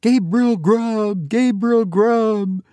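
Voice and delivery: high, drawn-out eerie chant; wild screaming chorus. A narrator's reading. Goblin voices filling the churchyard, chanting high. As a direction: wail the name slowly, pitched high. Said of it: "screamed a wild chorus of voices".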